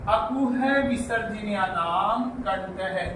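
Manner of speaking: slowly intoned sutra, every syllable spaced out; deliberate chant-like recitation. Shown as a demonstration. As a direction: recite the rule slowly for the class to copy.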